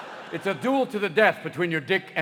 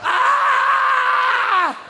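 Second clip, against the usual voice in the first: very high sustained yell; long comic scream; 8 dB louder than usual. Acted. Body torn between heart and penis, prolonged high scream. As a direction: hold a loud scream.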